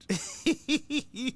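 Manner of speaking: high-pitched tittering laugh; high giggle. Real, not acted. Host teasing a listener who wants fish, high giggle. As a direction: giggle away at your own tease.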